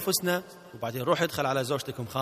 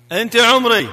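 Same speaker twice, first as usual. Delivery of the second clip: much louder and higher, projected; loud demo; 7 semitones higher, 12 dB louder, slower than usual. Shown as a demonstration. Trainer tells husband to say it louder, loud model. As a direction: belt out the phrase for the learner to copy. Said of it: "الحين قولها بصوت عالي شوي".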